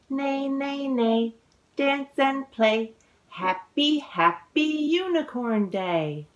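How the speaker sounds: lively, sing-song picture book reading; reading to children. A narrator's reading. Author reading her book aloud to children, sing-song. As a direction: read the rhymes with bounce for little listeners.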